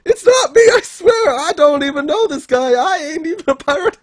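high, loud mock-desperate pleading; frantic protest. Voicing a mistaken wanted man to bounty hunters, frantic protest. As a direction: plead innocence at the top of your pitch.

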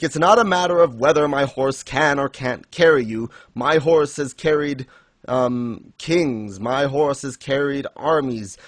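imitating a solemn, proud rider of legend; grandiose Death. Death refusing three riders his horse, grandiose retold speech. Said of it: "he's basically saying".

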